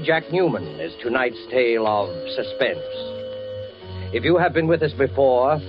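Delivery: ordinary talk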